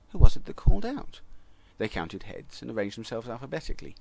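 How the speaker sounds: plain reading voice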